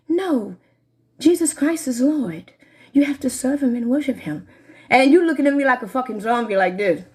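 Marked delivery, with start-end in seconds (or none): 0.1-4.5 mock pious voice. Mocking what she's expected to say, mild prim preacher voice. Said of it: "You think I can say".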